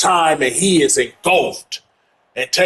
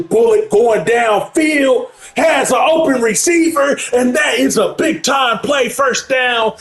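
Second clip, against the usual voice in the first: excited call; 10 semitones higher than usual. Real, not acted. Deep pass play-by-play call.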